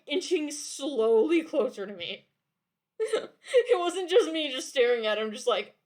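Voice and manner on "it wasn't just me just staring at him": laughing. Real, not acted. Streamer laughing at the mask enemy.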